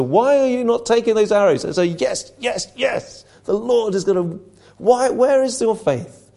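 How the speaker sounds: exasperated rebuke, where is your faith; angry frustration. Acted. Elisha rebuking the faithless king, exasperated and angry. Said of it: "he speaks angrily in frustration".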